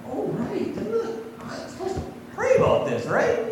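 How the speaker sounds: high, hushed, half-whispered mock dawning; a lightly hammed-up oh-right moment; mock sudden realization. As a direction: play the forgetful believer who suddenly remembers. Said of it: "then we go".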